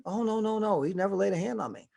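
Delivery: wife denying to the pastor; lightly voiced wife. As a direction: brush it off sweetly.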